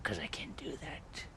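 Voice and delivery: soft unvoiced whisper. Shown as a demonstration. Showing chat how he could talk, hushed whisper. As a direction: drop to a hushed whisper and hold it. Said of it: "talking like this all the time".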